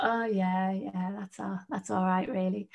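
half-hearted, trailing-off brush-off; mock dismissive. Recalling what she used to think of mindset training, mildly dismissive. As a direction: quietly wave it away, voice sinking. Voicing her old dismissive thought.

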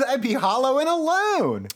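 high, stretched-out melodrama; mock lament. Cohost laments being untouched, mock-tragic. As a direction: wail it playfully, high and theatrical.

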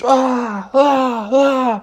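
short high cries; yelps. Acted. Zookeeper blocks the bear's paw swipes, high yelps. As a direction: yelp at each dodged swat.

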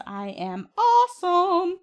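drawn-out, high, mock-grand brag; showy boast. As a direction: sing out your own greatness, slowly. Showy self-introduction in scripted intro.

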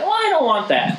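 mocking girlfriend. Imitating girlfriend's refusal.

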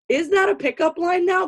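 high-pitched mock disbelief; incredulous. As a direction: toss it back in disbelief. Replaying her reaction to the guy.

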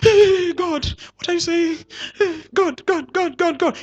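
high anxious calling; calling out to God. Retold or imitated. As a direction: shout up to a faraway God. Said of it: "He doesn't have to speak like that".

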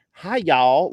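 high, loud Southern drawl; Virginia accent. Acted. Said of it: "they're all talking like".

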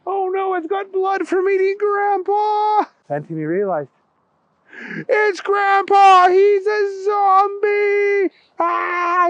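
put-on terrified boy. Timmy seeing grandpa's bloody face, high and wailing. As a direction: wail it out in horror.

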